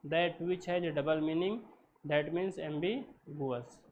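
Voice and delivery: slow, spaced-out definition; slow dictation. Shown as a demonstration. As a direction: read it slowly so they can write. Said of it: "teacher dictating definition slowly".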